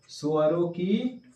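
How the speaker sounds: स्वरों की सहायता से हो said slowly, phrase by phrase; slow dictation. Shown as a demonstration. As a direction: spell out the definition slowly. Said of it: "किससे हो?". (परिभाषा धीरे-धीरे लिखवाना)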